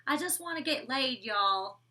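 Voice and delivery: hammed-up country accent; playful imitation of country speech. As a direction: put on a country twang for the quote. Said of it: "country accent".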